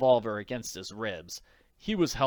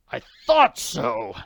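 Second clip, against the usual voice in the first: muttered bitterly; 10 dB louder, slower than usual. Acted. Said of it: "he muttered bitterly".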